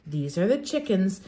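ordinary delivery

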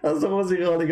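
long mocking laugh breaking into words. Real, not acted. Host laughing at the clip he's mocking, hearty laugh. Mocking a claim about heaven.

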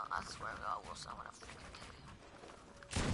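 low whispered threat. Real, not acted. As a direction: hiss the threat under your breath.

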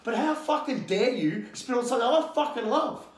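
fired up. Real, not acted. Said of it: "And obviously I get fired up".

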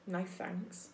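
soft, low badger grumble to himself; a hint of a grumpy badger muttering; grumbling badger. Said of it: "he grumbled to himself".

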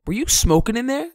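high, rising accusing question; suspicious stepdad. Retold or imitated. Stepdad questioning the kid leaving the bathroom, accusing.

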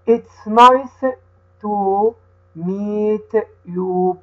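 slow, careful reading with each word set apart for the class to repeat; teacher reads the dialogue slowly so the children can repeat; slow read-aloud for repeating. Shown as a demonstration. Teacher reading slowly to children who repeat after her. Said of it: "هقرأها أنا وهتقول ورايا".

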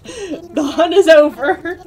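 slow, loud, laughing announcement; laughing triumph. Real, not acted. Streamer declares the hunt over, laughing loudly. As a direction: declare victory while laughing.

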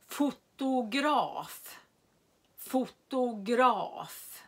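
Fotograf said slowly, long final a; careful repeated demo; slow model word. Shown as a demonstration. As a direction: stretch Fotograf for learners. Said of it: "lyssna på ljudet om jag säger lång vokal".

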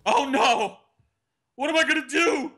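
high-pitched mock wail of dismay; mock panic. Mocking viewers upset about too many uploads, mock panic.

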